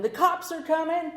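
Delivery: high urgent shout; yell. Retold or imitated. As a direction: call out a warning. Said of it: "I yell into the bathroom".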